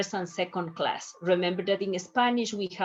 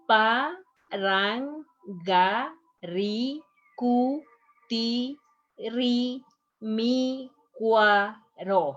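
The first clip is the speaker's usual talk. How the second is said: "parangaricutirimicuaro" very slowly, a gap after each syllable; teacher's slow breakdown of "parangaricutirimicuaro"; 2 semitones higher, much slower than usual. Shown as a demonstration. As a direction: sound out every letter of "parangaricutirimicuaro", one drawn-out syllable at a time. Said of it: "we have to pronounce all the letters".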